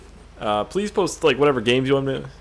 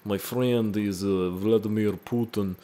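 mock Vladimir Putin in a thick Russian accent. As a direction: put on a comic Russian accent. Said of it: "trying to do a Russian accent".

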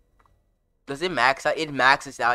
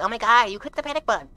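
mocking voice; 11 semitones higher, 8 dB louder, faster than usual. Mocking the panic button click.

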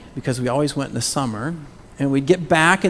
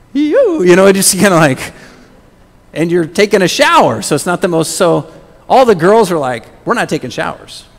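excited; 4 semitones higher, 10 dB louder than usual. Real, not acted. Excited cockroach story.

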